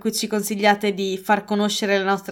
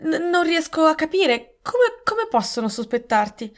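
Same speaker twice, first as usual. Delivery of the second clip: high, stammering bewilderment; confused wife, pitched up; 8 semitones higher than usual. A narrator's reading. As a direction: stumble over the first words, bewildered. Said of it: "Dinah riprese confusa".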